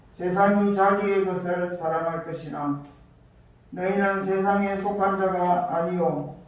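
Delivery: ordinary talk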